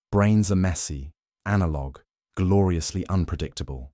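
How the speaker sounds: ordinary delivery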